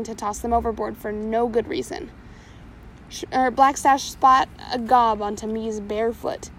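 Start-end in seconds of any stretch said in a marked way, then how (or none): none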